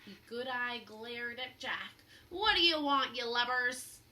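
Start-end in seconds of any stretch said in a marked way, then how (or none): 2.3-4.0 loud, barking pirate captain; captain annoyed at the kids at his window; gruff pirate. Acted. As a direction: snap at the lubbers. Pirate captain snapping at children.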